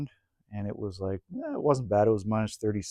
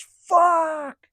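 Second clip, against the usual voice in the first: much higher, loud yelp of a curse; retold curse; 5 dB louder than usual. Buddy on the snowmobile yelling after a crash, high shout. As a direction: blurt it out in shock, high and loud. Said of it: "And he goes".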